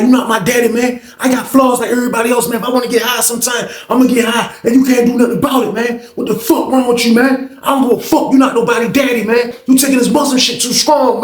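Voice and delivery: angry rant. Retold or imitated. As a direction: tell him off, shouting. Young dude snapping on Abdul.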